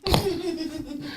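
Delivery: high, soft chuckle; amused laugh under the breath. Real, not acted. Reacting to question about misting fans.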